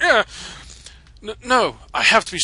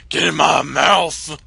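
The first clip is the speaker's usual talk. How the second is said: mock monster voice; 6 dB louder, slower than usual. Joking Wigglytuff's mouth entrance.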